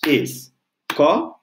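"escola" broken into separate syllables, drawn out. Shown as a demonstration. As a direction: split "escola" into slow, detached syllables. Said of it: "Vou separar ela em sílabas".

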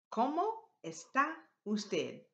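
broken into separate words with clear pauses; teacher's spaced-out phrase. Shown as a demonstration. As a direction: model each word separately for learners. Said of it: "Put that together".

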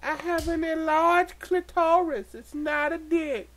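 high, slow put-on female voice; comic high-pitched character voice; female rabbit voice.

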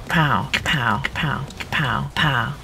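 whispered gunshots. Pretend. Whispered gun sound effects.

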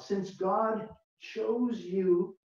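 very slow, each word drawn out; read slowly. Shown as a demonstration. Pastor reading scripture slowly to the congregation, slow and deliberate. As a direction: let every word land slowly. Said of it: "I'm gonna read it slowly".

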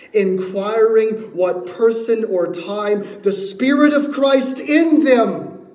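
loud Scripture reading climbing high; full-force preaching. Real, not acted. Preacher reads Peter's words to the congregation, full-force preaching. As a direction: drive the verse up to a slow, loud finish.

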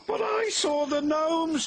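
high, slow pleading protest. Acted. Percy protesting to the Fat Controller. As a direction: insist he isn't lying. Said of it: "protested Percy".

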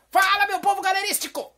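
very high, loud catchphrase yell; all-out shout. Shown as a demonstration. Host pushes [name] to say it with gusto, full shout. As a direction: scream the greeting with full force. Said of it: "tem que sair de jeito".

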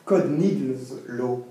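slow, measured counter-slogan; contre-slogan posé. Acted. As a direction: state it deliberately, drawing out each word. Contre-slogan proposé, posément. (formule lente, détachée mot à mot)

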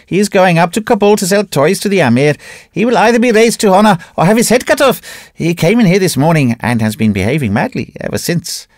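eager trader spilling the news; excited horse dealer. A narrator's reading. Horse dealer voiced in story.

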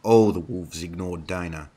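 ordinary delivery, nothing marked

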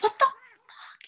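a high, loud, slow cry of disbelief; shocked outcry. Retold or imitated. Woman catching her boyfriend peeing, crying out in shock. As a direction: let the shock break out of you.

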